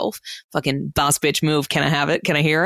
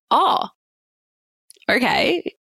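taken-aback oh okay; 9 semitones higher, slower than usual. Retold or imitated. She hides annoyance at boyfriend.

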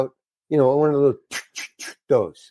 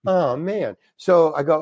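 normal talking voice

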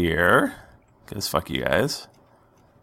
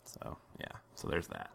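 whispering; 3 semitones lower, 19 dB quieter, faster than usual. Real, not acted. GM murmuring after damage.

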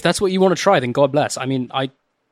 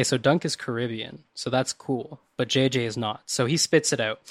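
ordinary delivery

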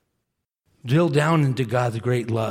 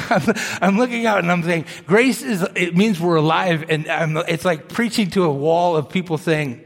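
higher, louder, urging the room; fervent preaching; 5 semitones higher, 6 dB louder than usual. Acted. Preaching at full force.

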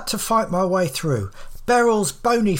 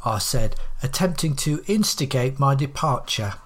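ordinary delivery

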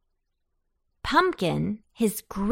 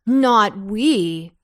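loud, punchy cabbage outburst; exclaiming; 7 dB louder than usual. A narrator's reading. Cabbages joining pumpkin's refusal, exclaiming. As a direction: exclaim it loudly, all in protest. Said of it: "exclaimed the cabbages".

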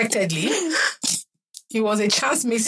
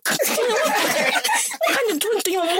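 put-on very high, slow sing-song baby talk; mock baby talk to a child; 10 semitones higher, slower than usual. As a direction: ham up the silly adult cooing at a child. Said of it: "moment of stupidity".

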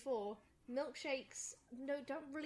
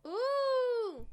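excited squeal; 12 semitones higher, 10 dB louder than usual. Real, not acted. Delighted at wine delivered.

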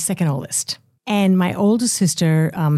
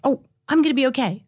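higher, fast, plucky self-reassurance; mock-cheerful warrior pep talk; brave self-talk; 7 semitones higher, faster than usual. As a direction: chirp it like a soldier refusing to break. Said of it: "I was such a warrior".

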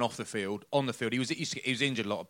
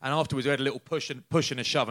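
usual speaking voice

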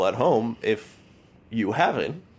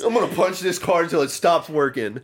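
high-pitched, hammed-up declaration; mock fighting boast; 7 semitones higher than usual. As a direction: absurd threat played up high.

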